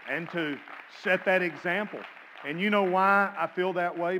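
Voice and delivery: loud, high fervent cry; preaching at full force. Real, not acted.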